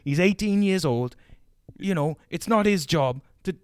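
a hint of a furious Welsh teacher; mock angry rant. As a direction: rip into the mum in a put-on voice. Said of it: "And he just fucking went off".

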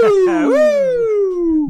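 loud, long hoot; high sustained whoop. Pretend. Cohost joining in on the show title, loud whoop. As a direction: hold a high "woo" and let it fall.

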